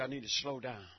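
low, quiet, pulled-back phrase; soft slowed delivery. Shown as a demonstration. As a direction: bring it right down and hush. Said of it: "I say".

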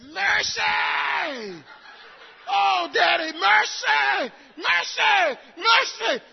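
high, loud pleading cries of mercy; son begging for mercy. Retold or imitated. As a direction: beg Daddy for mercy with rising desperation. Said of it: "He said it so sincere".

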